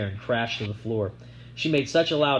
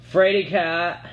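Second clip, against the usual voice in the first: taunting; 6 semitones higher, 5 dB louder, slower than usual. A narrator's reading. Sissy taunting.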